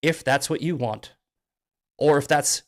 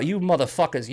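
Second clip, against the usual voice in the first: ordinary talk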